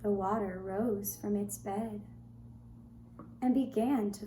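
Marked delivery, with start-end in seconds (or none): none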